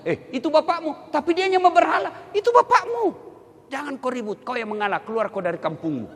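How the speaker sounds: admonishing voice. Retold or imitated. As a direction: scold firmly then settle. Allah admonishes Ibrahim.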